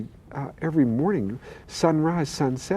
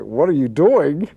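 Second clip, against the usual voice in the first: alarmed mother; 8 dB louder, slower than usual. Retold or imitated.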